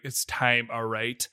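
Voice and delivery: mimicking an actor's Irish lilt, quiet and low; lightly imitated Irish accent, low and soft. Host mimics Sam Neill slipping from Brazilian, lightly Irish accent. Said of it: "that's his, like, Irish accent".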